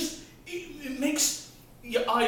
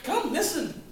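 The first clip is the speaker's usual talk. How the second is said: normal talking voice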